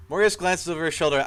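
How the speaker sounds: ordinary talk, nothing marked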